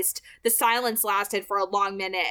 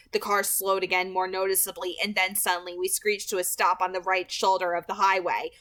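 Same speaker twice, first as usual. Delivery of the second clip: ordinary delivery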